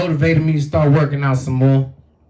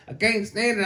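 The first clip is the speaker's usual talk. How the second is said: normal talking voice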